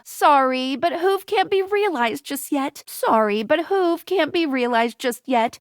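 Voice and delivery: ordinary talk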